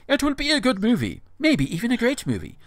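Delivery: put-on dramatic non-American accent with big pitch swings. Host reading out the author's prediction, theatrical put-on accent. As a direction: recite it grandly in an affected foreign accent. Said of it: "Please continue with an American accent".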